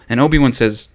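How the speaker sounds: usual speaking voice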